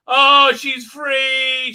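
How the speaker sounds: loud, high, very drawn-out mock cheer; hammed-up slow-motion shout; slow-motion cry. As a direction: stretch every word as if in slow motion. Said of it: "This is all in slow motion".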